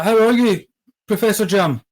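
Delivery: normal talking voice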